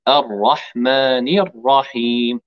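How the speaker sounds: slow الرحمن الرحيم with one tap of r; single tap r. Shown as a demonstration. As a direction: tap the r only once, slowly. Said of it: "لابد أن نقول راء واحدة".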